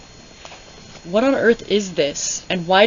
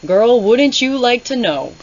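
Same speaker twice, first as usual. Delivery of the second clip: loud, high, cheeky sass; sassy; 6 semitones higher, 8 dB louder than usual. Real, not acted. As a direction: fire back with a smirk. Cheeky retort to book.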